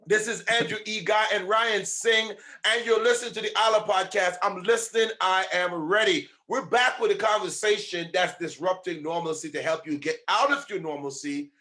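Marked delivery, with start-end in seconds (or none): 0.1-9.5 podcast host intro. Acted.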